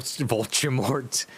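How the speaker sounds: name squeezed out through laughter; laughing. Real, not acted. Reader losing it over the name, laughing.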